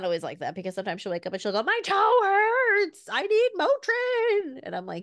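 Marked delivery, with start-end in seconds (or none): 1.6-4.6 high, loud, slow wail; hammed-up, drawn-out little-kid cry; wailing toddler. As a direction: imitate a small child wailing in pain. Mother voices child waking in pain.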